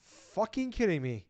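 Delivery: high, drawn-out incredulous outrage; retold gamer fury at a pointless errand; exasperated disbelief. Gamer ranting at the game's errand, high exasperated disbelief. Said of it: "I'm like".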